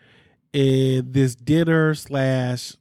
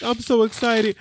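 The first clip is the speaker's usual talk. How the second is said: fake tears; 8 semitones higher than usual. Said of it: "with the fake tears".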